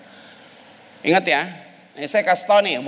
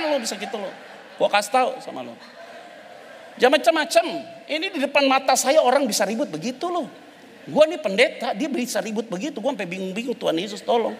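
fiery preaching; 14 semitones higher than usual. Acted. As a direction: preach it at full force, high and driving. Preacher's fiery stretch on quarrels. (khotbah berapi-api, nada tinggi)

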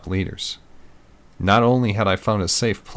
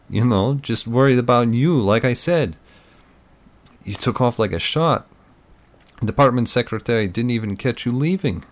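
higher-voiced friend, lightly on edge; nervous buddy; 5 semitones higher, 3 dB louder than usual. A narrator's reading. Tim tells his friend he was worried, uneasily chatty. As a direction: play the chatty buddy, pitched up.

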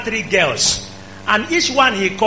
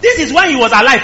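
usual speaking voice

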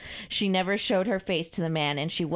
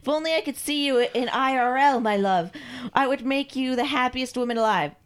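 higher, ardent servant voice; lovestruck submissive servant; 6 semitones higher than usual. Acted. Carl pledges love to his mistress, adoring. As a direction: declare devotion to your mistress.

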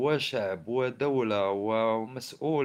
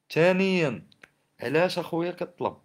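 loud; 6 semitones higher, 5 dB louder than usual. Real, not acted. Indignant rant about the state.

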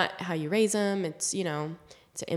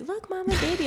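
put-on high excited little child; high toddler delight; 12 semitones higher than usual. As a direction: squeal it like a toddler pointing. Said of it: "Atlas would be like".

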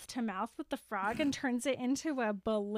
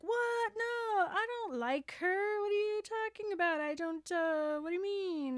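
very high mock-innocent protest; flustered denial; 8 semitones higher than usual. Shrek denying he likes Fiona, flustered high denial.

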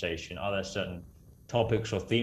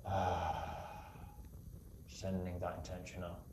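guided meditation; 4 dB quieter than usual. Acted. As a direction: guide them gently into calm.

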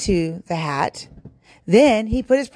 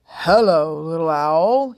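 louder storybook fox voice; hungry fox; 3 dB louder, slower than usual. A narrator's reading. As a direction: greet your prey as a hungry fox. Hungry fox greets his prey.

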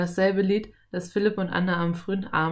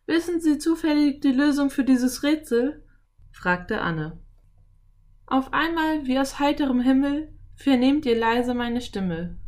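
put-on high, louder girl's voice; raised pitch, girl putting a riddle; questioning girl; 8 semitones higher, 5 dB louder than usual. A narrator's reading. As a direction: recite a riddle as an eager girl. Girl poses a riddle to cowboy.

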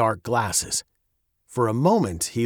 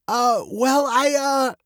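hesitant, high-pitched stammering; faltering; 12 semitones higher, slower than usual. A narrator's reading. Father dodges daughter's question about pearls, faltering. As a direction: stall for an answer with broken starts. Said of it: "he faltered".

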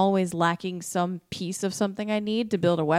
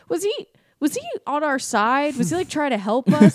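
higher-pitched, puzzled questioning; mock bewilderment; 7 semitones higher, 4 dB louder than usual. Rescued characters puzzled by the bigger virus, bewildered. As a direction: act out baffled survivors, pitched up.

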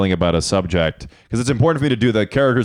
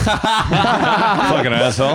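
laughing; 8 semitones higher, much slower than usual. Real, not acted. Laughing at own awkward answer.

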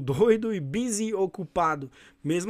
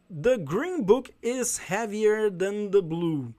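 slow model reading; 2 semitones higher, slower than usual. Shown as a demonstration. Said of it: "teacher models example sentence".

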